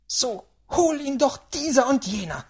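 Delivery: loud, high cursing; fuming old lady; angry old woman. A narrator's reading. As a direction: curse crossly as an irate great-aunt. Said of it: "zürnte die Alte".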